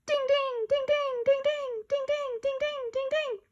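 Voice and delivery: high bell-like dings; chiming dings. Acted. Kids imitating the sale notification, high chimes. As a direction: ring out like a chime.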